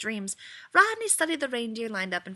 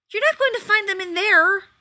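high, loud, sharp bark; Rodney barks at Shepherd searching his pockets; 11 semitones higher, 11 dB louder than usual. A narrator's reading. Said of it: "Rodney barked".

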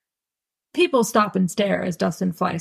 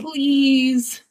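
higher, drawn-out plea; pleading; 6 semitones higher than usual. A narrator's reading. Son interrupting mom's cheery refusal, pleading. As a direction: cut in with a begging "please".

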